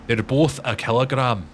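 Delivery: insistent Scottish correction; mock-Scottish, leaning on "both"; Limmy. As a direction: correct them in a put-on Scots accent. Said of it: "quoting a Limmy show".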